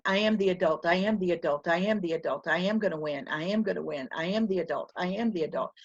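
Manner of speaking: low, even self-talk chant; internal mantra. Acted. Teacher steeling herself against a crying child, internal mantra. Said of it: "That's my internal dialogue".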